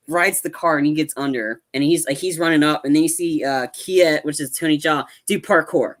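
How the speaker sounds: ordinary talk, nothing marked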